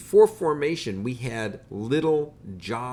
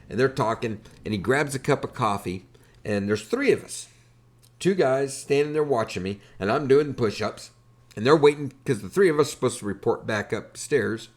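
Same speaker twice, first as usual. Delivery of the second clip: normal talking voice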